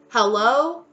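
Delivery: loud hello swooping up high; exasperated hello. Real, not acted. Reader trying to get through to divine masculine, exasperated. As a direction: question it loudly, as if to someone not listening. Calling out an oblivious listener.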